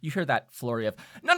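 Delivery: ordinary talk, nothing marked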